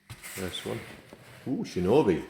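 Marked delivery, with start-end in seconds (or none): none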